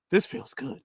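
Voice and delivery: breathy, hushed blissful sigh; mock relieved sigh. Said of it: "he said".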